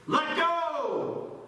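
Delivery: high, loud drawn-out command; booming voice from above. Acted. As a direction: call it down from the heavens. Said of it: "then he heard this voice say".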